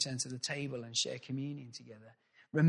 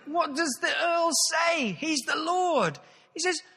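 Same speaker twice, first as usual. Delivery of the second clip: lingering comic voice; 13 semitones higher, 6 dB louder, slower than usual. Acted. Preacher joking to the congregation, voice still high and loud. Preacher mid-impression, pitch stays raised.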